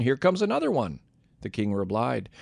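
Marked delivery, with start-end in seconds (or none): 0.0-1.0 high-pitched narrated call, only a little louder. A narrator's reading. Watchman calls down to the king from the gateway roof, calling down. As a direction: lift the voice high as if calling from above. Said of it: "He shouted down".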